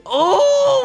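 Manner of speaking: very high, loud wail; anguished cry. Real, not acted. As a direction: cry out high at a near miss. Just missed both tiles.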